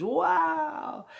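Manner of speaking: high, loud drawn-out exclamation of wonder; amazed crowd cry. Retold or imitated. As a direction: imitate an awestruck crowd. Everybody amazed as ship lifts.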